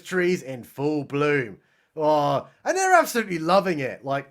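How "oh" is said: ordinary talk, nothing marked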